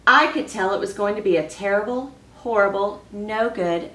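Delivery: reading a picture book aloud to the class; expressive read-aloud for children; story reading. Acted. Teacher reads story aloud.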